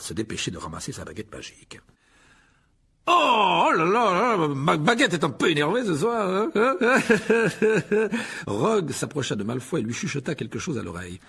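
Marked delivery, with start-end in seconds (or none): 3.1-6.8 high, loud embarrassed yelp into a giggle; flustered laughing cry. A narrator's reading. Lockhart laughs off dropping his wand before the students. 6.8-8.4 forced high giggle; nervous high laugh. A narrator's reading. Lockhart laughs nervously at his own blunder.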